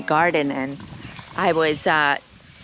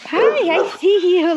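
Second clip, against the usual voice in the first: very high, loud cooing greeting; 13 semitones higher, 5 dB louder than usual. Real, not acted. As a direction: coo loudly at the sheep. Greeting sheep.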